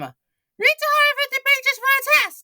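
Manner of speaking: high shouted order; mean teacher voice. Acted. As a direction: bark it. Miss Ribble in comic.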